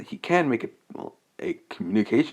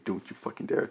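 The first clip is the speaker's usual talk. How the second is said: soft, breathy menacing warning, much quieter; a cold, flat-eyed murmured threat; hushed deadly warning; deadpan threat; 7 dB quieter than usual. Retold or imitated. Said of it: "with dead eye".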